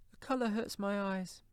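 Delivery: high mother's voice, holding back grief; grieving mother. A narrator's reading. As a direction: voice her high, excusing her tears. Mother covers her grief.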